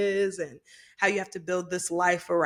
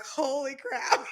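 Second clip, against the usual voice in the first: half-laughing, half-crying holy crap; laughing disbelief; 5 semitones higher, 5 dB quieter, much slower than usual. Real, not acted. Guest reacting to host's surprise, laughing. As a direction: gasp it out through laughter.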